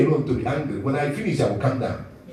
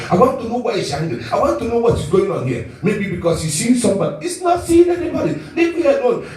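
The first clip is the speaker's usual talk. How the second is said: jealous spouse; 6 semitones higher, 3 dB louder than usual. Retold or imitated.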